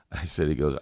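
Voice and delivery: normal talking voice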